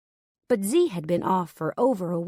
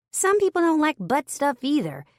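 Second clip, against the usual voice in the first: higher, deadpan coworker voice; Turner's voice; 9 semitones higher, 3 dB louder than usual. A narrator's reading. Turner startling everyone with a blunt remark, high deadpan. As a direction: say it straight-faced and high.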